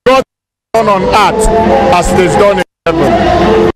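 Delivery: ordinary talk, nothing marked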